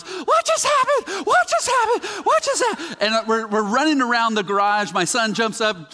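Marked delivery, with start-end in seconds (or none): 0.0-3.0 high, stunned yelling of the same question; screaming in disbelief. Retold or imitated. To friends in the garage after the home run, a stunned high yell. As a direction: shriek it like you can't believe your eyes. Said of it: "scream was".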